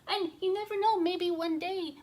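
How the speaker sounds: high-pitched, earnest pleading; apologetic little bee. Acted. Bee begs the king not to punish it, high pleading. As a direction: plead in a tiny creature's voice. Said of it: "got more courage and said".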